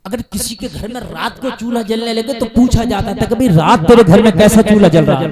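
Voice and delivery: loud, ringing sermon voice, pushing fast at the end; full-force preaching. Acted. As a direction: call it out to the whole gathering. (Josheela was, ऊँची आवाज़ में)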